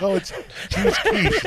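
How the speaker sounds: high, mocking waiter voice. Mocking the diner waiter serving him quiche, high put-on voice. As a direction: lampoon the server pushing the new dish.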